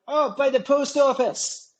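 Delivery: slow, very high fake Scottish accent; put-on Scottish accent. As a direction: bluff as a Scot, stretching it out. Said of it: "I put on Scottish".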